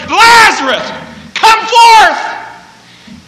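very high shout to raise the dead; loud commanding call. Acted. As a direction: summon with all your lungs. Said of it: "he called out in a loud voice".

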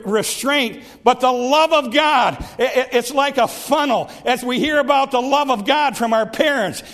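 fervent preaching, climbing in intensity; full-force preaching. Acted. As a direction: push it home with growing fervour.

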